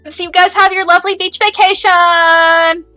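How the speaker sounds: high, loud, slow send-off; drawn-out cheery farewell. Acted. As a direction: stretch the last word out big. Character signs off from the call.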